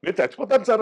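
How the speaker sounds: overwhelmed fan. Retold or imitated. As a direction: gush like a fan about to faint. Fan's overwhelmed reaction voiced. (ఆనందంతో ఉప్పొంగిపోయిన అభిమాని గొంతులో)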